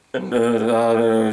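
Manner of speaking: low, droning gibberish; unintelligible phone agent. Retold or imitated. Agent answers the order number, low droning gibberish. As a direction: mumble nonsense in a low monotone. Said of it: "and the person went".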